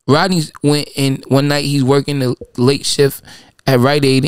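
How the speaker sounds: ordinary talk, nothing marked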